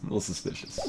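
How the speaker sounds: a soft unvoiced repeat to yourself; whispered aside. Real, not acted. Streamer muttering to himself about the suspicious witness. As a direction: breathe it as a private note. Repeats comment under breath.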